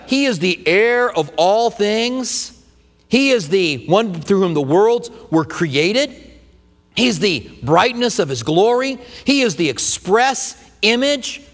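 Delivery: slow, weighty preacher's recital; slow preaching emphasis. Acted. Pastor to congregation, slow solemn preaching. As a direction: lean on every phrase, slow and solemn.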